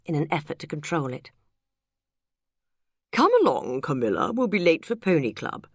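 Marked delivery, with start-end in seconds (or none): 3.1-5.7 hammed-up plummy English upper-class accent; mock-posh English accent rounding up the kids. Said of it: "said Lou in a plummy accent".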